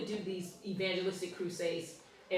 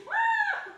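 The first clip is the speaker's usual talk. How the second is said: sudden high yelp of fright; mock scream; 6 dB louder than usual. Husband bursting from the laundry room, a high mock scream. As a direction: shriek it like the husband did. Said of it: "He was screaming".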